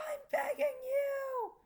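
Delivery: begging. Acted. Girl begs the vampire, slow and high. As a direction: beg desperately. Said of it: "I pleaded".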